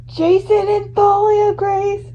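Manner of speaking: high, slow, awed gasp of names; stunned realization. Acted. Fan recognizing the siblings, high and awed. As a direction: draw the names out in shock.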